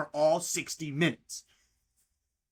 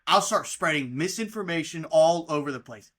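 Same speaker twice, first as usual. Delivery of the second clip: ordinary talk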